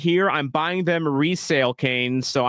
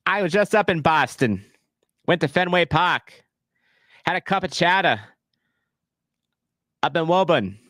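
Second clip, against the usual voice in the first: a wavering put-on Boston accent; lightly done Boston accent that slips in and out; 2 semitones higher than usual. Streamer telling chat about his trip in a wobbly Boston accent. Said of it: "that was in Boston. It kind of wavered".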